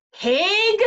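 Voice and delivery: high, loud shout. A narrator's reading. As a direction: fling the word at the pig. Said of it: "shouted Eugenia".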